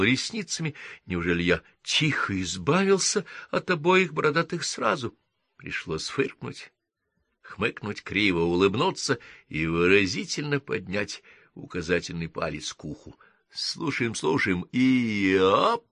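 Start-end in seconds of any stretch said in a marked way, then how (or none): 1.1-5.2 surprised. Acted. Elf's imagined astonished question. 13.5-15.9 playful countdown. Acted. Hero counts down to the crash.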